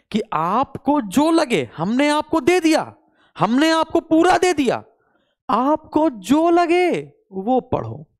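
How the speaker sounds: high, drawn-out appeal; emphatic plea. Real, not acted. Teacher appealing to all students to study what they like, pleading. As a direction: plead slowly, stretching the phrase endings high. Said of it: "विनम्र निवेदन है सभी बच्चों से".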